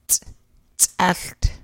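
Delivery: "tsalt" with a popped ts as in cats; glottal ts. Shown as a demonstration. Teacher modelling glottal C for learners, popped ts. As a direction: pop the ts hard in "tsalt". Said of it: "Glottal C as in the word cats".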